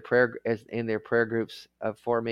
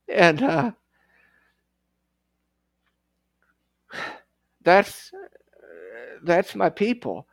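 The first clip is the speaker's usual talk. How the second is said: choked up; 5 semitones higher, 3 dB louder than usual. Real, not acted. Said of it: "I'm laughing to keep from crying, blubbering".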